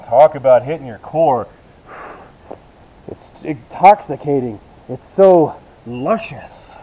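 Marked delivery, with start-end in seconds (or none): 3.1-6.8 slow savouring. Real, not acted. Coach savouring the move.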